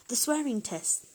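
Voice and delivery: hammed-up crew shout; pirates shouting. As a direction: call it out like a rowdy crew. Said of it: "shouted the pirates".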